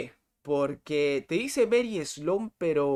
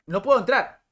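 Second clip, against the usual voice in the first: frustrated shout; 8 semitones higher, 8 dB louder than usual. Real, not acted. Streamer failing to log in, shouting in frustration. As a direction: yell it out, much louder and higher. Can't get into the game.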